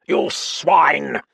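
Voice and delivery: loud, high enraged yell; shouted with hate; furious shout. A narrator's reading. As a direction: hurl it at him in fury. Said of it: "shouted Sir John".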